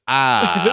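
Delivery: high, deliberate "ah" said as a word; spoken-word "ah" scream. Retold or imitated. Host showing how the actress says her scream, mock "ah". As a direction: say the scream, don't scream it. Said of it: "she's saying the word".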